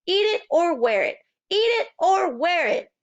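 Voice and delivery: put-on high, loud chanting toddler; a small boy yelling at his father; toddler scream. A narrator's reading. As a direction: shout it like a cheeky little kid chant. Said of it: "Fudge screamed".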